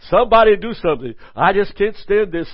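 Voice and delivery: fast, loud, high frantic plea; hammed-up panic, laughing through it; tormented demon. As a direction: play a demon who can't stand it.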